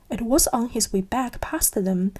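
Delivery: ordinary delivery, nothing marked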